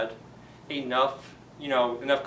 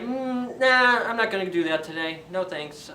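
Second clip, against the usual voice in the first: dismissive; 6 semitones higher than usual. Acted. Painter refusing.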